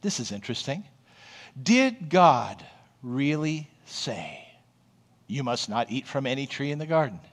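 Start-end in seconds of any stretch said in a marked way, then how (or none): 1.6-7.2 serpent voice. Acted. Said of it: "And the serpent said to the woman".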